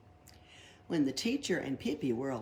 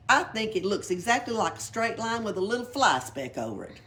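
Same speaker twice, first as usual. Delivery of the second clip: cheeky; 5 semitones higher, 6 dB louder than usual. Acted. Pippi mocks the letter.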